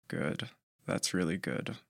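quiet hoarse praise. Acted. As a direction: rasp it softly. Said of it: "His voice was hoarse".

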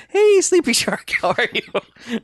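very high, drawn-out cooing greeting; hammed-up high-pitched child voice; imitating little boy. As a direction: coo to a sleeping animal in a squeaky kid voice. Little boy talks to sleeping shark.

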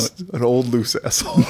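lightly voiced worn-out old asshole; old loose asshole. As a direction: play a tired old sphincter. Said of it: "Very breathy".

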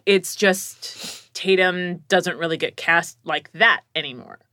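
normal talking voice

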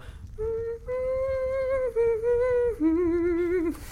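long high falsetto note; soprano squeal. Acted.

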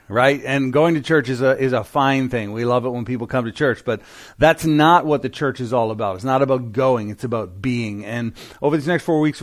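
ordinary delivery

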